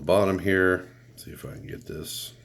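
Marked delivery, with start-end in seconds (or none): 1.2-2.3 hushed whisper while inspecting. Real, not acted. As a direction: whisper it under your breath. Peering under the deck.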